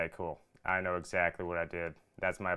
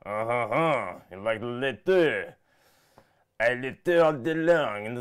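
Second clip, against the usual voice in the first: a hammed-up, higher French-Canadian accent, a bit louder; terrible French accent; 4 semitones higher, 7 dB louder, slower than usual. Host teasing his French-Canadian friend, in a hammed-up French accent. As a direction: tease your friend in a bad French-Canadian voice. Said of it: "I do a terrible French impression".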